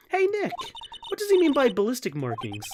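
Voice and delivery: high, questioning sidekick voice; curious sidekick. Acted. Sidekick asks Nick about ballistic markings, high and puzzled. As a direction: pitch it up, puzzled. Voices Nick's companion in game.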